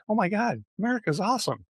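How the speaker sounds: high-pitched, delighted exclamation; amazed tourists. Acted. Retelling foreign visitors' reaction, amazed and excited. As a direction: act out visitors thrilled by America. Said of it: "they're just like".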